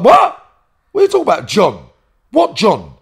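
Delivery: high and loud, baffled outrage at the accusation; retold shocked shout. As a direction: blurt it out, stunned and loud. Accused of robbing John, retold.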